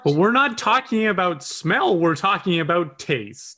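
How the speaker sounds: indignant objection. Real, not acted. Objecting in argument.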